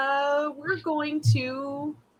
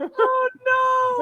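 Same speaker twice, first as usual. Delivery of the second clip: mock wail; 12 semitones higher, 5 dB louder than usual. Dramatic wail at fighter.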